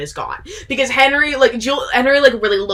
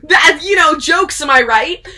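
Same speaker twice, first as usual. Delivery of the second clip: mocking laugh; 7 semitones higher, 5 dB louder, slower than usual. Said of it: "the idiot, is like".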